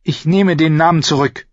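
put-on high-pitched girl character voice; insistent young girl. A narrator's reading. Girl claiming she understands, high and insistent.

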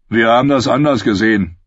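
quick outlaw character voice; gloating villain's retort; sardonic Hound. Acted. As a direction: counter her with dark mockery.

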